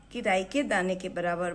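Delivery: ordinary talk, nothing marked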